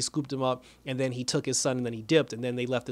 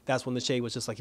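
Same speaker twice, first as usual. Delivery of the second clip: normal talking voice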